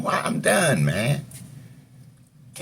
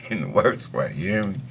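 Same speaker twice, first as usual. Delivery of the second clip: usual speaking voice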